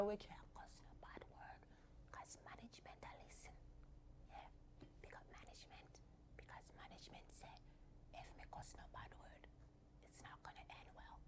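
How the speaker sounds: hushed whisper so management can't hear; conspiratorial whisper about the bosses. Real, not acted. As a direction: whisper it like a secret. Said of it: "management is listening".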